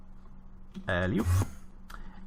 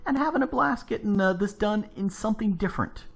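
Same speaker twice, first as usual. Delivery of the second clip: excited sign-off; 10 semitones higher than usual. Real, not acted. Cheerful sign-off to viewers.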